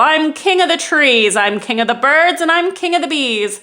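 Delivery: loud, gloating turtle king; boastful king. Acted. Yertle boasting from the top of the stack, loud gloating. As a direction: savour every word of self-praise.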